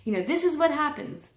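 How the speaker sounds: mock the angry parent she used to be; old me snapping. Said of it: "an old me would be like".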